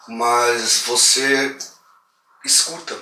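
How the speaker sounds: very slow, drawn-out build to escuta; suspenseful slowdown. Real, not acted. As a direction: stretch it out to build dread. Building suspense about hearing.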